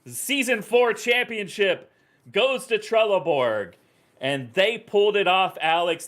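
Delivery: high, excited winning call; elated live sports commentary, pitched high; championship play-by-play. Real, not acted. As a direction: proclaim the title win as it happens. Calls the championship win.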